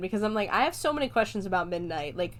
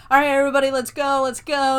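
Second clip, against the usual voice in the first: loud, higher call to the class; mock teacher rounding up the class; 5 semitones higher, 9 dB louder, faster than usual. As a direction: herd the kids out loudly.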